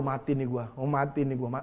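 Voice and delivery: low, soft, hopeless groan of words; mock despair. Debtor waking up to unpaid debts, low hopeless despair. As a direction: mutter it like a doomed man in bed.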